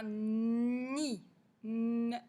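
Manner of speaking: NID with long n, then N alone; elongated initial n. Shown as a demonstration. Teacher shows the first sound, "nid" stretched at n. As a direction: sustain the n of "nid". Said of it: "c'est le son N".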